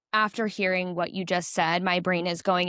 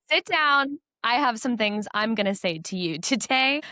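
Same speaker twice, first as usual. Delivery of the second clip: higher-pitched mock-stern therapist; imitated therapist; 3 semitones higher than usual. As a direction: play the therapist laying down the law, pitched up. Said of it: "your therapist was like".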